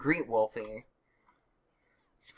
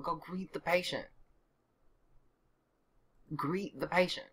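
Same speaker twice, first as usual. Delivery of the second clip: normal talking voice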